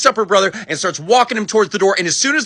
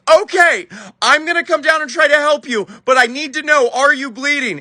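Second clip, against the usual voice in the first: high, earnest imitation of a rescuer; urgent helper; 7 semitones higher than usual. Woman to the crashed uncle before helping, urgent. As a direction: pitch it up, checking on someone hurt.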